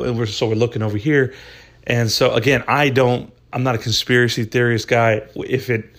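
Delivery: normal talking voice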